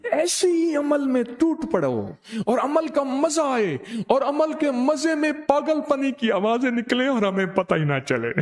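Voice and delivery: high, fervent urging; fervent exhortation. Real, not acted. Preacher urging disciples to devour practice, fervent. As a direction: urge them on with rising zeal.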